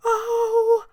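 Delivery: put-on little girl spotting the cutest puppy, high excited shriek; excited scream. A narrator's reading. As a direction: squeal like a thrilled little girl. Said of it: "she screamed".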